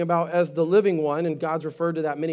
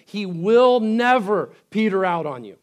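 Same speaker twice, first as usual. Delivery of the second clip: normal talking voice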